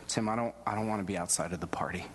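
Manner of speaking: retold tearful plea, whispered. Grandfather tearfully whispering to Tim, voiceless and broken. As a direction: breathe it out like an old man near tears. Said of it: "And he started to cry".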